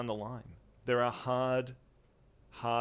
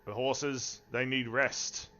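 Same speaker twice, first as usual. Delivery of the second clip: gruff voice. Acted. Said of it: "you hear a gruff voice".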